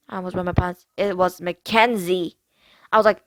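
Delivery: ordinary delivery, nothing marked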